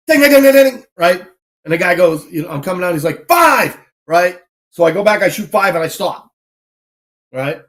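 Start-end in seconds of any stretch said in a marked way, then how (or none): 0.1-0.8 rapid high, loud string of dings; imitated plate dings. Shooter recreating his run on the plates, imitated dings. 3.3-3.8 high, loud shouted count; imitated yell. Range officer calling out a missed plate to the shooter, imitated yell. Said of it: "he yelled five".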